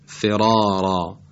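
فرارا slowly, with thick emphatic r's; heavy emphatic r. Shown as a demonstration. The teacher modelling the word for students, slow and heavy.

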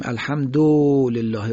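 الحمدلله with the u of du dragged out long; wrongly stretched vowel. Shown as a demonstration. Teacher showing listeners the mistake, stretched short vowel. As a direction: overstretch the du in الحمدلله before lillah. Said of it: "او رو بکشیم صحیح نیست".

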